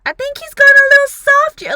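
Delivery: hammed-up mock sympathy for Azula; mocking father. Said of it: "And it was just like".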